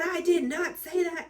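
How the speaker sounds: high-pitched indignant imitation; mad denial. Imitating the partner denying the go-ahead, mad denial. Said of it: "And she gets so mad".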